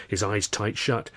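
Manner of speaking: usual speaking voice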